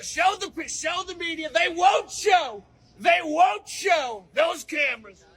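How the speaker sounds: hammed-up outrage at the cameras; mock rally shout. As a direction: bellow at the press pen. Complaining at rally crowd.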